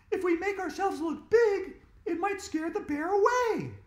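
put-on high, loud bossy girl; urgent girl. A narrator's reading. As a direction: command the friend urgently. Andrea explaining plan.

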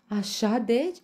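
high, loud sarcastic quaver of fright; mock fear. A narrator's reading. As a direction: pretend to be scared, teasingly. Lion mocks the meerkat's threat. (frică prefăcută, batjocoritor)

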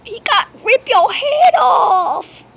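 slow, wailing half-laugh; laughing cry. Acted. Friend panicking over the game, slow laughing wail. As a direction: drag it out in a laughing shout. Frantic over a gruesome act.

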